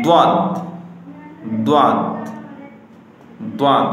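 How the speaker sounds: দাদ with a heavy, full-mouthed emphatic D; thick দাদ. Shown as a demonstration. Teacher drills the heavy letter for learners, thick দাদ. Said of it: "মোটা হবে।".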